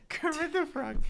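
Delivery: very high, loud teasing frog voice; high-pitched mock frog voice; Kermit the Frog. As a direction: tease with a high puppet squawk. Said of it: "Sorry, I joke with you".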